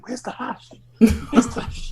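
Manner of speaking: high, urgent mock mate; high-pitched imitation of a panicking friend; mate's panicked question. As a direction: squeak it out like the frantic mate. Mate waking him, retold.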